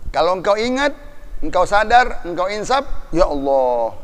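loud and high, warning the proud; preaching at full force. Acted. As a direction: thunder a warning at the powerful. Preacher urging restraint. (khotbah berapi-api, keras dan tinggi)

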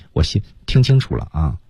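usual speaking voice

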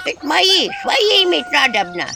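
high creaky refusal; creaky house spirit. Acted. House spirit creakily refusing the imps.

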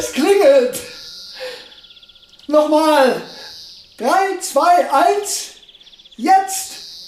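can't contain the joy, demand it again; thrilled excitement. Acted. Bell rings, scientist thrilled, repeats. (begeistert und aufgeregt, viel höher und lauter)

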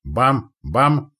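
two loud imitated gun booms; high, punchy cannon bangs; booming cannon shots. A narrator's reading. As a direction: voice two bangs in quick succession. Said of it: "громыхнули две пушки".

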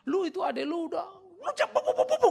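high, loud, spluttering imitated rant; mock raging. Preacher mimics his friend blowing up, hammed-up rage. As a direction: imitate someone going berserk. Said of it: "ngamuk dia".

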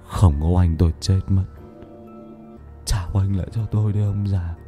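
crazed muttering. Acted. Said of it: "mồm lẩm bẩm trong ngây dại".

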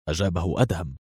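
ordinary delivery

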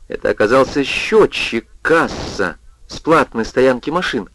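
plain reading voice

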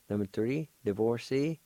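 normal talking voice

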